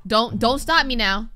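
usual speaking voice

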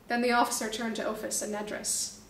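ordinary delivery

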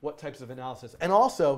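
ordinary delivery

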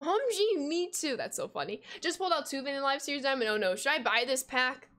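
ordinary talk

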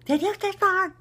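high, loud mock husband voice; husband's mock question. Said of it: "and then he says".

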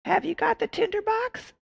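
very high shrill witch cry. Acted. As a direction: squeak it like an old witch. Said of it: "asked the witch".

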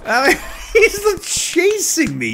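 laughing through the words. Real, not acted. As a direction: giggle while panicking. Being chased by angry man.

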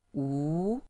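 Wu rising upward; second tone wu. Shown as a demonstration. Teacher models the second tone, rising. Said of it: "second tone going up".

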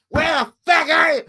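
high, loud imitation of an angry wife; mock furious shout. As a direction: yell it like a wife demanding answers. Angry wife on phone, retold.

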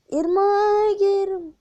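Irmağa gidiyorum shouted high and loud; belted call. Acted. Repeats line, belted out.

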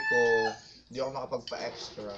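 speaking between bites; chewing while talking. Real, not acted. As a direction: mouth partly full of food. Seen 1: Holds chicken near mouth, lips working as if chewing between bites.

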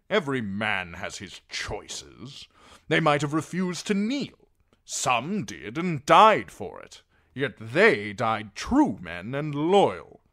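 slow, loud, weighted retort; Sir Axel, unyielding. A narrator's reading. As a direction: hammer out the judgement deliberately.

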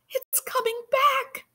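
very high frightened yelp. Acted. Andrew seeing the creature return, high yelp. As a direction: yelp it in panic. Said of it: "I yelped".

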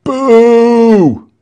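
high, very loud booing cry. A narrator's reading. Someone in the crowd booing the soldiers, very loud. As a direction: boo them as loud as you can. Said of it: "Someone else cried".

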